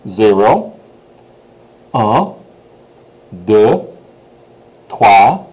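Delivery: French numbers one at a time with long gaps; rising teacher prompts, leaving room to repeat; call-and-response counting. Shown as a demonstration. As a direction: count slowly for a class to echo. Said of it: "I'll go, you go, back and forth".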